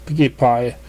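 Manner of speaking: plain reading voice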